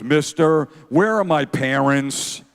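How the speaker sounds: loud, drawn-out imitated kid; lost-child plea. Son pranking dad before a stranger, loud mock lost-child plea. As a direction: call out slowly like a child playing lost.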